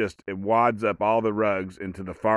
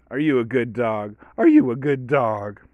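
doggy voice building louder; baby talk; 2 semitones higher, 5 dB louder than usual. Retold or imitated. Owner praising the dog with a question, in baby talk. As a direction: coo it, then gush it louder at the dog. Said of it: "You baby talk it".

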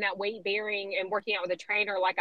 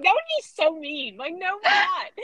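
higher, louder, laughing protest; laughing denial; 8 semitones higher, 4 dB louder than usual. Retold or imitated. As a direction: deny it through a laugh. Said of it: "they're like".

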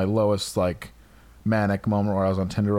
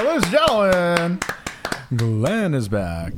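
announcer voice; 8 semitones higher, 3 dB louder than usual. Pretend. Announcer introduction of guest.

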